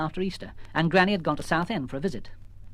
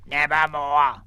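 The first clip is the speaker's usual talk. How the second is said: croaked by a raven; a bird's flat croak; 4 dB louder, much slower than usual. Acted. Mortimer croaking back at Missus Jones.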